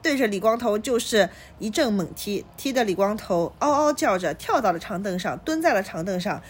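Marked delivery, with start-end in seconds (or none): none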